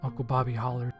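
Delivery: usual speaking voice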